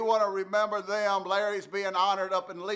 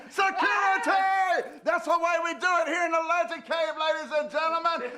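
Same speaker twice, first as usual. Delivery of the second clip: hammed-up shout; 7 semitones higher than usual. Radio host hollering for security to drag a caller off. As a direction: bellow for the guards like a showman.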